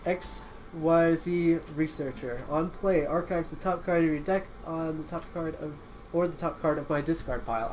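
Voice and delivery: ordinary delivery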